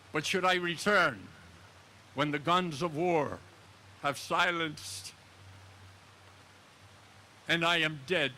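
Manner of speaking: slow solemn recital, rising to a plea; poem recitation. Acted. Veteran reciting his own verse to the crowd, solemn and pleading. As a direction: recite it like a graveside prayer.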